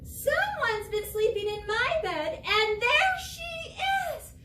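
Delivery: small cub squealing with discovery; high excited Baby Bear. Acted. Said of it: "He says".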